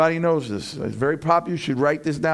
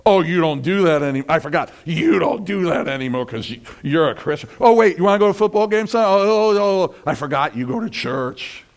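sarcastic mocking friend, louder; ridicule; 4 dB louder, faster than usual. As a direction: sneer at his new faith. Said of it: "to ridicule".

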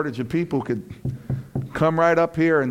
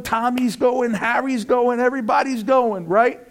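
put-on old party buddies; 6 semitones higher than usual. Old friend urging a new believer to the bars, pushy. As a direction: egg him on like an old drinking pal. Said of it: "and somebody said".

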